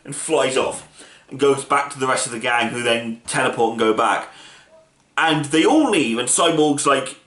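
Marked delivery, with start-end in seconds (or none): none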